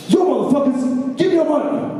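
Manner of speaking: high mock stickup shout; hammed-up hold-up line; Mexican robber voice. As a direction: play the switchblade robber, over the top. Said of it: "he'd be like".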